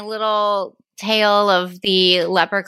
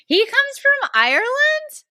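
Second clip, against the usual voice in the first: genuinely stunned, high; real astonishment; shocked question. Real, not acted. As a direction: pitch leaps up in disbelief. Shocked at quiz answer.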